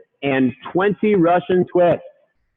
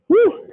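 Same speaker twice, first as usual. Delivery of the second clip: excited whoop. Real, not acted. Coach finishing the dips lets out an excited whoop.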